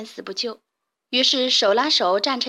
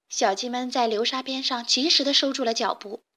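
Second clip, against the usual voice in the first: usual speaking voice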